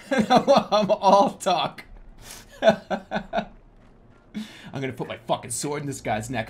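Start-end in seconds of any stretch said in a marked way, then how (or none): none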